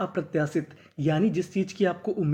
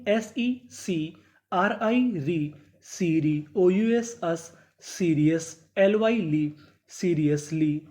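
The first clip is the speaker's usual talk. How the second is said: 1 very slow spelled-out reading; much slower than usual. Shown as a demonstration. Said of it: "syllable-by-syllable reading demo".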